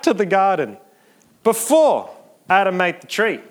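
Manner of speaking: loud, ringing sermon climax; preaching at full force. Real, not acted. Preacher proclaiming forgiveness to the congregation, loud climax. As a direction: build to a shout on the promise.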